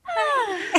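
high squealing gasp of delight; hammed-up excited gasp; mock delighted gasp. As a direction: act out spotting her friend. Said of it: "I'm like".

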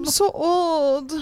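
put-on old voice. Aged character complains.

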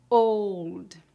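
put-on Old in an English accent. Shown as a demonstration. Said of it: "Pronúncia fechada".

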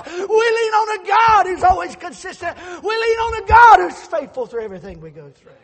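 shouted, high, ringing sermon climax; fiery preacher at the peak of the sermon; full-force preaching. Acted. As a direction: preach it out to the rafters, loud and high.

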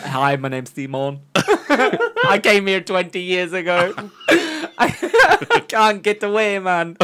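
slow, giggly mock Jamaican; comic Jamaican character voice; Jamaican accent. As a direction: put on a joking Jamaican drawl between laughs. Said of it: "That's a Jamaican accent".

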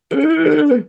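high groan. Pretend. Mock despair at cliff edge.

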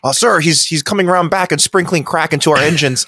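hammed-up panic to the captain; mock alarmed officer. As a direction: crewman warning his captain, lightly hammed up. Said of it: "crew reporting to captain".

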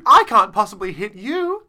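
high, loud parody of uptalk; hammed-up high voice, lifting "you"; mock upspeak. Joins in the exaggerated upspeak demo.

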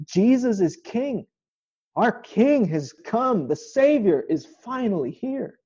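ringing out like a crowd greeting its king; joyful proclamation. Acted. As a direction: announce the king has come, slowly.

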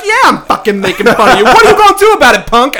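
loud, rising taunt; mock confrontation. Student taunting a classmate on the staircase, loud mock challenge. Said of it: "I would make fun of people".

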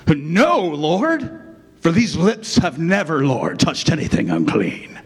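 a stagey English actor's take on a proud Peter; English accent. Acted. Peter proudly refusing God in a mock English accent. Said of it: "I don't do an English accent, or I try".

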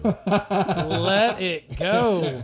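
loud laughing speech. Real, not acted. Teasing the host about fame, laughing loudly. Joking about being ignored.

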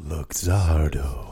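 very low, very slow dramatic voice; deep announcer voice. Acted. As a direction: stretch it out deep and slow like a movie trailer. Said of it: "[name] has just done that voice saying Luxardo".

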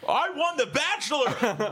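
high, slow, laughing brag; boastful reality star. Acted. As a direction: crow it like a smug reality star.

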